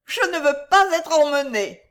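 high, loud female wail; hammed-up high-pitched complaint; fainting lady. A narrator's reading. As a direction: protest as a swooning spinster. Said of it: "murmura la dame évanouie".

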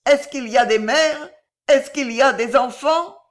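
high, loud distracted questioning; hagarde. A narrator's reading. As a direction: cry out the questions, bewildered. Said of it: "dit la bohémienne hagarde". (questions hagardes, aiguës et fortes)

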